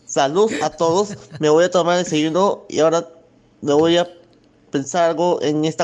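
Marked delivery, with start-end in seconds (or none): none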